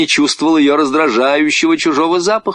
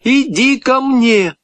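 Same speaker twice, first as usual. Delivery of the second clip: put-on high, slow girl's command; trying to sound confident; 14 semitones higher, slower than usual. A narrator's reading. Frightened girl ordering the dog over, trying confidence. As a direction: summon the dog, high and effortful. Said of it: "старалась говорить уверенным голосом".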